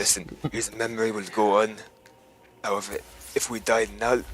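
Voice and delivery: slight Scottish slip in character; faintly Scottish role-play voice; accidental Scottish accent. Acted. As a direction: let a little Scottish creep in. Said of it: "I'm already turning Scottish".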